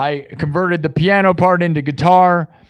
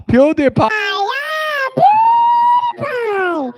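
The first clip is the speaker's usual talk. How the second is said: shrill-free high shout of a name; hammed-up Swedish cry, much higher; mock cheer; slower than usual. Streamer yells PewDiePie's name in mock Swedish accent. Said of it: "PewDiePie says in his Swedish accent".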